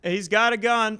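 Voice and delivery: high-pitched mock police warning; acted alarm call. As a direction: cry it out as a panicking cop in a bit. Acting out the police shooting.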